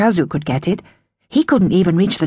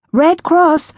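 higher, monotone droning voice; 10 semitones higher, 4 dB louder than usual. Acted. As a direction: repeat it like a machine, eerily flat. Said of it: "the woman droned".

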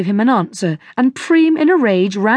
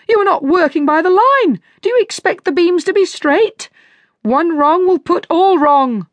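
berating; 9 semitones higher, 3 dB louder than usual. A narrator's reading. Shoemaker berates carpenters for crooked beams, furious.

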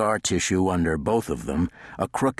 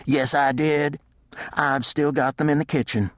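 higher, lightly voiced housekeeper; 9 semitones higher than usual. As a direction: answer like a helpful housekeeper.

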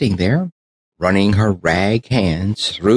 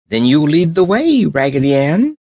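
higher and louder, keen call; eager cry; 7 semitones higher, 4 dB louder than usual. Acted. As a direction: urge her on brightly. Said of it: "cried the French dolly".

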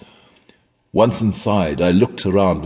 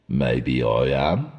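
put-on evasive old man; 7 semitones lower, 4 dB quieter, slower than usual. A narrator's reading.

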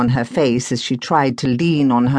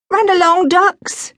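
fond big sister; 3 dB louder than usual. Acted. Penelope sending sisters off.

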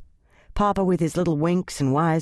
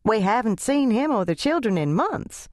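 higher, chirpy lady voice; chirped; 6 semitones higher than usual. Acted. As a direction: chirp it like a blue jay. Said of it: "Missus Ingle chirped up".

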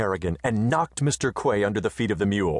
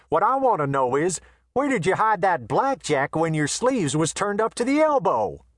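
a swaggering Western tough, raised high; brash gunman voice; 10 semitones higher than usual. A narrator's reading. Quay asks, dazed.

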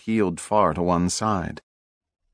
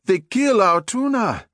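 much higher, anguished cry; outraged cry; 12 semitones higher than usual. Acted. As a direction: wail it in outrage. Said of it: "the nephew cried".

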